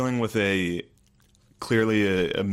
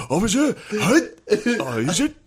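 mock surprise; 11 semitones higher than usual. Mock surprised reaction opening.

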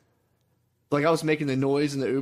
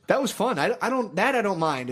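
higher, insistent "That was fun."; emphatic; 7 semitones higher than usual. Real, not acted. Insists after the jab.

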